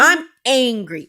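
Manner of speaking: slow, loud mock-angry kid; drawn-out, hammed-up ANGRY; mad little boy. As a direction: imitate a child flatly insisting on his anger. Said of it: "he's mad all the time".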